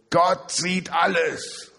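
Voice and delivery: high, slow, loud ghostly call; eerie slow proclamation; booming hidden voice. Acted. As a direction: boom it out like a voice from nowhere. Hidden voice scaring burglar.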